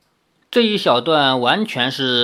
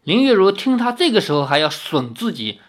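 ordinary delivery, nothing marked

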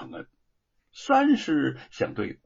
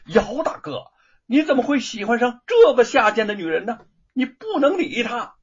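louder and higher, an indignant rebuke; loud outburst; 7 semitones higher, 6 dB louder than usual. Acted. Wu rebukes his friend for loving that woman, loud and indignant. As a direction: bursts out at him in anger. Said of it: "大声地说".

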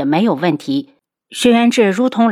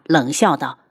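ordinary delivery

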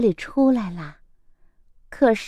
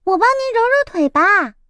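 eager little chick; 9 semitones higher than usual. A narrator's reading. Chick offers help.

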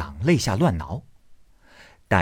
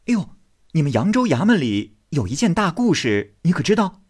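coaxing; 8 semitones higher, 4 dB louder, faster than usual. Acted. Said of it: "便哄他道".

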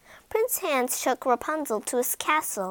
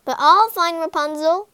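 louder, higher defiance; defiant shout; 6 semitones higher, 8 dB louder than usual. Acted. Blinded prince shouting at the witch, loud and defiant. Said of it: "he shouted to the witch".

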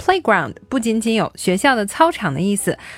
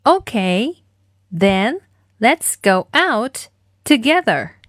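slow, broken-up model line; spaced-out demo for repeating; slow follow-along model; much slower than usual. Shown as a demonstration. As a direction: draw each chunk out slowly. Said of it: "一起来练习今天的跟读作业".

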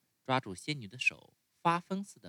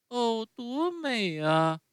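又高又响、拖长的欢呼; 像孩子乐得跳起来那样喊; 高兴地大叫; 14 semitones higher, 6 dB louder, much slower than usual. Acted. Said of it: "匹诺乔大叫，高兴地跳起来". (用很高很响的童声慢慢欢呼)

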